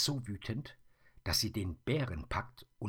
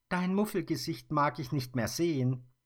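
put-on higher, louder girlish voice; stubborn girl; 10 semitones higher, 6 dB louder than usual. Mia refuses to see the grumpy face, higher and louder. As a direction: tell him off playfully. Mia turns the bear around.

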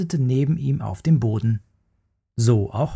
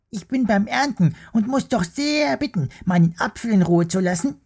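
cross little worm; 9 semitones higher than usual. Acted. Worm scolds the bear for disturbing him, squeaky and cross. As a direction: scold like a tiny fussy creature.

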